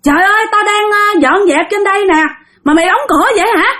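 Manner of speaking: high, fast, irritated scolding; scolding from upstairs. Retold or imitated. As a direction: snap at them for shutting the door. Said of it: "chửi rằng á là".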